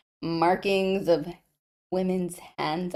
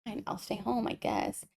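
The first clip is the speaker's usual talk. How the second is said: muttered grudging reply, much softer; sulky teen mumble; 13 dB quieter than usual. Retold or imitated. Heroine sulking at her guardian, softly muttered. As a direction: grumble it under your breath. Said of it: "she's like".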